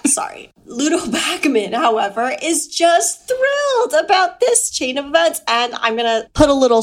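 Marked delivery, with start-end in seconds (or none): none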